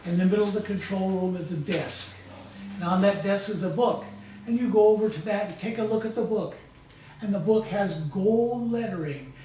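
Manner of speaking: calm, measured hypnotic storytelling voice; guided hypnosis. Acted. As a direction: lead them gently through a visualization, pausing often. Leads a guided visualization.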